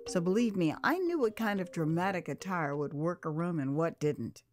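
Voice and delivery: ordinary talk, nothing marked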